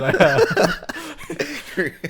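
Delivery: slow words dissolving into high laughter; laughing. Real, not acted. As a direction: crack up at your own line. Laughing at own dark joke.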